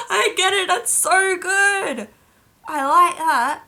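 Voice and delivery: high, loud delight after a laughing fit. Real, not acted. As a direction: squeal it out, drawn out and loud.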